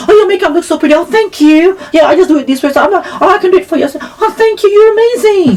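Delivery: high, chirpy compliments; bubbly makeup chat, pitched high; sweet gushing. Retold or imitated. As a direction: charm the women. Women chatting about makeup.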